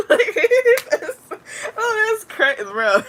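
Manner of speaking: high and loud, cracking up mid-word; real laughter breaking through the words; laughing hard. Real, not acted. Host cracking up over her son's line, real laughter.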